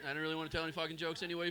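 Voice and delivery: ordinary talk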